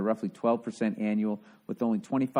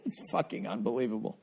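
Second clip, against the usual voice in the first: words through leftover laughter, softer; laughing; 4 dB quieter than usual. Real, not acted. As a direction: laugh it out in disbelief. Still laughing after impression.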